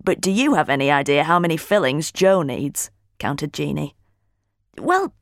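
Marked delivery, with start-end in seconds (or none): 4.8-5.1 higher-pitched, indignant huff; huffy. A narrator's reading. Holly defends dentist husband by blaming Caro, huffy. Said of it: "I huffed".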